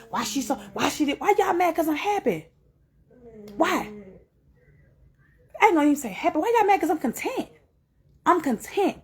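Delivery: high-pitched, exasperated questioning; indignant challenge. Real, not acted. Woman challenging critics of her happiness, indignant and high-pitched. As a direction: push back in a high, incredulous voice.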